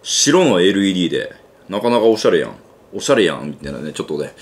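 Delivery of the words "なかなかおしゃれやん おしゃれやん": slipping into Kansai dialect, おしゃれやん with a Kansai lilt; casual Kansai-dialect praise, おしゃれやん; relaxed Kansai accent, おしゃれやん as an offhand compliment. Real, not acted. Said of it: "関西弁出ちゃったけど".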